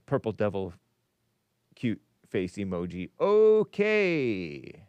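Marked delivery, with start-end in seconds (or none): 3.2-4.8 higher, drawn-out falling okay; imitated voice sliding down from high; David in Ocala. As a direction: stretch it out as a caller impression. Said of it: "David in Ocala's voice".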